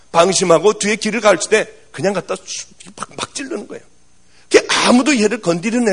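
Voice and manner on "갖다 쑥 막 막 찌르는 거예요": hushed whisper of the stabbing; whispered, retelling the boy's knife attacks. Retold or imitated. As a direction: breathe it out like a dark secret. Boy stabbing from behind, hushed.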